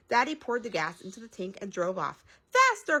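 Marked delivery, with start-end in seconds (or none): 2.5-3.0 high, loud urgent cry; crying out faster. A narrator's reading. Mother crying out to daddy, high and loud. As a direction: cry it out to hurry him. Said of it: "cried Mommy".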